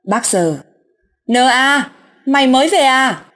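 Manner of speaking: mock-call out to a friend just back; calling out loud. Two old friends loudly greeting the returned father. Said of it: "hai bác hỏi to".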